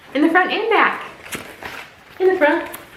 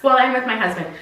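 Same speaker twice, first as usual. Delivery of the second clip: ordinary talk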